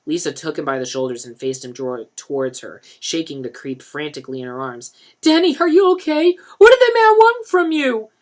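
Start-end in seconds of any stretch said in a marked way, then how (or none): 5.2-8.1 much higher, louder frantic woman. A narrator's reading. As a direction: shake answers out of him. Lisa frantically questions Denny.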